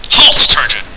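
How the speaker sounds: loud, abrupt order; commanding halt. Acted. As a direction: stop the intruder with a forceful call. Unseen host stops the intruder.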